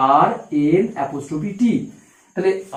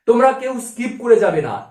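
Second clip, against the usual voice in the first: ordinary talk, nothing marked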